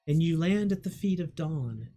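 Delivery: ordinary delivery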